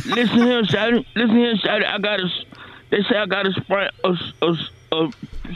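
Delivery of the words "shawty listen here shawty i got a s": normal talking voice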